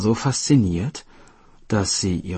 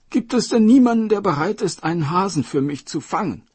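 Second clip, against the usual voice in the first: much higher and louder royal cry; called out; 11 semitones higher, 6 dB louder, faster than usual. Acted. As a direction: cry out impatiently to the hall. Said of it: "Rief der Drachenkönig".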